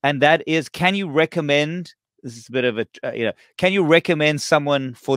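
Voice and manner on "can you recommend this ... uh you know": normal talking voice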